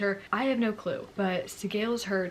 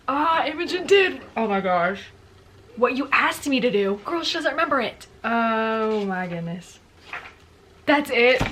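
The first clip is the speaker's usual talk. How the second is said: shocked outburst; 2 semitones higher, 8 dB louder than usual. Real, not acted. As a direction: cry out in real shock at the twist. Shocked at ending of book.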